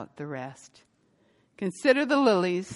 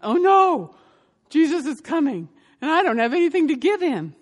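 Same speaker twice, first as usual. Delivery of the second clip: higher, louder, faster cry of alarm; panicked dismay; 8 semitones higher, 7 dB louder than usual. Retold or imitated. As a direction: blurt it in a rush of panic. Woman panics, kitchen empty.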